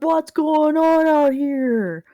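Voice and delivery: loud, high, drawn-out holler; character calling out. Acted. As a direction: step out and shout it slowly. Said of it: "He's like".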